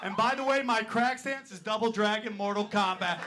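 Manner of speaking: usual speaking voice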